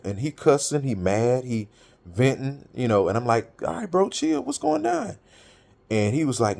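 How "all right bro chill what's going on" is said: much higher, easygoing calming tone; retelling telling a friend to chill. Retold or imitated. Telling his angry friend on the phone to chill, raised pitch. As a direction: talk a heated friend down, lightly.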